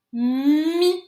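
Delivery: "Mi" with a long hummed m; drawn-out, loud "mmmi"; sounding out the syllable. Shown as a demonstration. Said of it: "Qu'est-ce qu'on entend en premier dans mi?".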